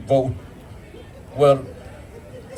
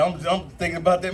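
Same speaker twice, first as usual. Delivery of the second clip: normal talking voice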